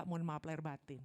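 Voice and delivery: normal talking voice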